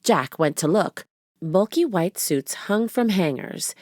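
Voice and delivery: plain reading voice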